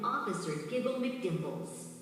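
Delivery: plain reading voice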